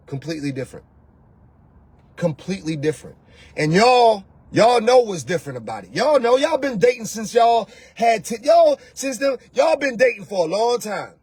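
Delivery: ordinary delivery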